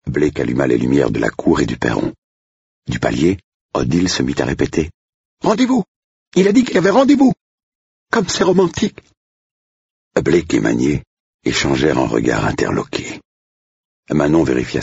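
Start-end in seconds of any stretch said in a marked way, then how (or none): 5.4-9.0 very high, excited squeal of a voice; piercing high voice, breathless with excitement; shrill excited cook. Acted. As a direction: pitch the cook way up in a giddy squeal. Odile gushing.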